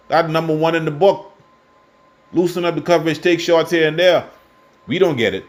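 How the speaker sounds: getting carried away with frustration; emotional rant. Real, not acted. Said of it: "Sorry, I getting a little emotional".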